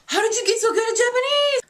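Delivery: high, wondering imitation of a question; mock amazement. Confused listeners asking about her Japanese, mock amazement. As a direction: imitate someone astonished, pitched well up.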